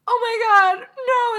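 retold uncle's hammed-up fake wail; mock crying. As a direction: ham up a wailing fake cry. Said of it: "starts faking crying".

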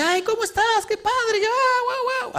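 mock effusive woman greeting a friend on the street; gushing greeting. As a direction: squeal it like meeting a long-lost friend. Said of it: "Y dice".

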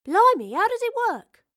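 put-on high, eager cockney girl; bubbling with excited curiosity. Astrid amazed.

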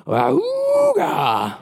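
high, drawn-out car horn honk; old-fashioned horn imitation. Preacher mimics an angry driver's silly car horn, high drawn-out honk. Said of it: "when he hits the horn, it goes".

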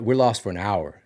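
normal talking voice

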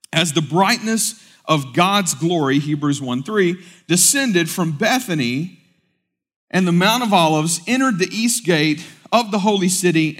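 high, loud, emphatic sermon delivery; preaching at full force. Acted. Pastor preaching to the church, full force. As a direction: proclaim it to the whole congregation.

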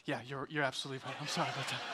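low, soft, hurried admission; sheepish apology. Retold or imitated. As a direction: mumble a quick embarrassed apology. Sheepish retold apology to guard.

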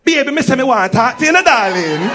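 mock a pouncing Jamaican woman. Jamaican woman pouncing on English visitor, mock Jamaican accent. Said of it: "She heard the English accent and said".

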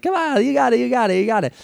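high, bouncy coaxing; bouncy encouragement. Retold or imitated. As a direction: cheer the actor on lightly. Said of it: "bouncy way of, like, laughing".

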